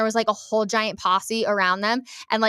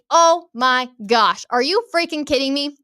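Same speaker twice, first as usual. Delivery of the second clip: stunned disbelief; 5 semitones higher, 4 dB louder than usual. Retold or imitated. Host retelling shock at who the boot woman was, stunned disbelief.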